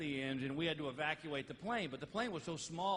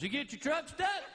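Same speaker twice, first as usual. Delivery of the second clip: much higher, loud incredulous officer; loud patrolman question; 11 semitones higher, 3 dB louder than usual. Retold or imitated. Patrolman to trucker, loud high question. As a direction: bark the obvious question.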